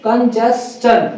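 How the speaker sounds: slow "कंजेस्टन" with a clear -s-chən; ch-ending pronunciation. Shown as a demonstration. Teacher repeating for students, "कंजेस्टन" slowly with ch ending. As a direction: stretch "कंजेस्टन" out as kən-jes-chən. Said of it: "क्या बोलेंगे?".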